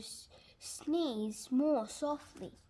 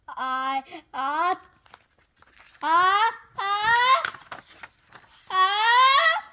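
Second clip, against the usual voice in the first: rising, louder stammered I before a sneeze; building sneeze; 7 semitones higher, 12 dB louder, slower than usual. Acted.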